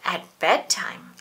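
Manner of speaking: ordinary delivery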